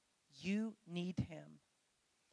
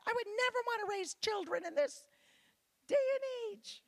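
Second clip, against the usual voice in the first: high, quavery grandma impression, louder; old lady voice; 13 semitones higher, 7 dB louder than usual. Mimicking grandmas to the ladies, high old-lady voice. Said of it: "Every old lady I've ever met has said".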